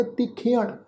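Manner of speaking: ਤਿੱਖਿਆਣ as tikh-yaan; teacher's model of ਤਿੱਖਿਆਣ with final retroflex n; demonstrated pronunciation. Shown as a demonstration. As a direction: glide kh into y in ਤਿੱਖਿਆਣ. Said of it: "ਇਸ ਦਾ ਪਾਠ ਉਚਾਰਨ ਕਰਾਂਗੇ".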